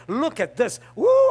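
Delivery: ordinary delivery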